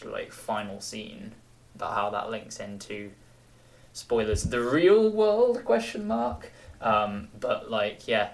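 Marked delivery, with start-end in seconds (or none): 4.4-6.5 high drawn-out question. Real, not acted. Teasing ending twist.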